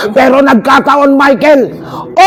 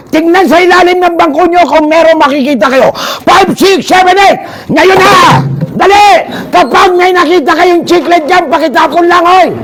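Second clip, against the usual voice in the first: excited hype-man holler; shouted urging; 8 semitones higher than usual. Real, not acted. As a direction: hurry the whole crowd along. Urging crowd to search under seats.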